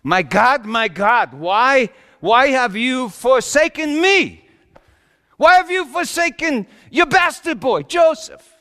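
loud, high cry to heaven; anguished screaming. Acted. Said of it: "It was screaming".

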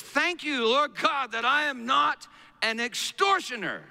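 high, declaimed mock prayer; praying Pharisee. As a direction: pray aloud like a self-righteous Pharisee. Preacher acts the Pharisee's prayer.

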